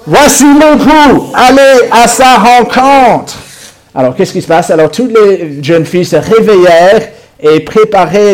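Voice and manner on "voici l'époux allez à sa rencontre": high, slow loud announcing call; loud cry. Acted. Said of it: "On va tous dire ça. On cria".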